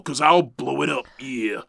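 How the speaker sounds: lightly voiced wrestler promo; a hint of wrestling promo bravado; Macho Man impression. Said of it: "Macho says".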